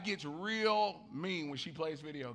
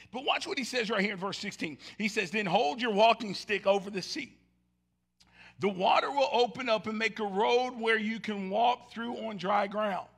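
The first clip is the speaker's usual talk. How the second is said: louder, declaiming the verses; preached scripture reading; 5 semitones higher, 8 dB louder than usual. Acted. Pastor reading Exodus to the congregation, preaching. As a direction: proclaim the verses with force.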